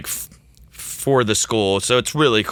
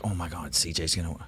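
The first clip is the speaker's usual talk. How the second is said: much softer, hushed aside to a colleague; quietly at the table; 4 semitones lower, 11 dB quieter than usual. Retold or imitated. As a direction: lean in and murmur the prediction. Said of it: "like, quietly at the table".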